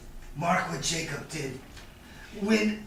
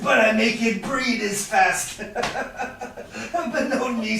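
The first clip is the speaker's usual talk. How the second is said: louder, laughing through the boast; 4 semitones higher, 5 dB louder than usual. Acted. Shylock to Antonio about breeding gold, laughing. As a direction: chuckle at your own cleverness.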